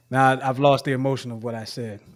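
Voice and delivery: usual speaking voice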